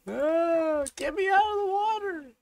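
high, loud desperate scream; high shrieking plea; frantic scream. Real, not acted. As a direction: scream to be pulled out. Trapped in water again.

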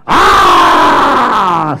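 long, loud "aah"; loud scream. Acted. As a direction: let out a sustained loud cry. Performer's sudden roar on stage.

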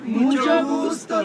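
high, drawn-out feminine put-on greeting; gushing falsetto greeting. Man in a dress meeting famous Diana, gushing falsetto. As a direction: gush it slowly in a high voice.

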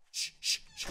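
unvoiced repeated shushes; hushing. Real, not acted. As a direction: hush everyone as danger passes. Hiding from the passing horde.

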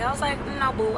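usual speaking voice

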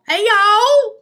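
high, loud hey y'all cracking into a laugh. Pretend. Echoing her co-host's greeting, laughing. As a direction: copy the drawl and break up laughing.

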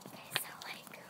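breathy hushed aside; whispering. Real, not acted. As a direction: drop to a whisper. Hushed aside while walking.

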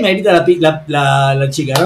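usual speaking voice

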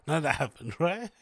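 laughing through the words. Real, not acted. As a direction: giggle while you deny it. Laughing while denying wild rumors.

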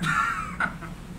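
short laugh acting out the outburst; narrator's burst of laughter. A narrator's reading. Narrator laughing as Kenneth bursts out. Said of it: "Kenneth bursts with laughter".

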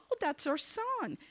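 put-on high-pitched, sweet old parents; sweet elderly couple. Elderly couple speaking of their son, high sweet voice. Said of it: "sweet little couple opens the door".

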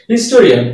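"হিস্টোরিয়ান" with its opening H pronounced; sounded H. Shown as a demonstration. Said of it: "এইচ এর উচ্চারণটা হচ্ছে". ("হিস্টোরিয়ান" শুরুর এইচ উচ্চারণ করে)

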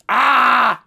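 loud exasperated "ah!"; frustrated yell. Real, not acted. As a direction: yell out in frustration at a botched attempt. Gives up on failed voice.

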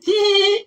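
tiny very high giggle noise; giddy hee. Retold or imitated. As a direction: squeak out a mock giddy titter. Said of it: "this little giddy sort of like".

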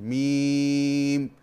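ميم held very long and slow; long madd. Shown as a demonstration. Teacher repeats the long ميم for the student. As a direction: stretch ميم like recitation. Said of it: "أنا أيضًا مديت الميم".

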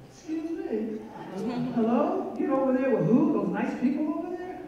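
high, incredulous "hello?" comebacks; mock indignation. Retelling her reply to the rude driver, high mock indignation. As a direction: act scandalised at being herded.

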